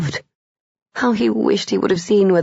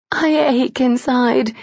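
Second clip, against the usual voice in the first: aching cry; 7 semitones higher than usual. A narrator's reading. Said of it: "she cried".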